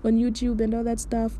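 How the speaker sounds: lightly voiced sister pushing the money angle; sister's urging. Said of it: "like my sister, was all like".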